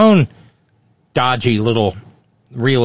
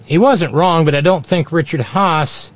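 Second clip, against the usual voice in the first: ordinary talk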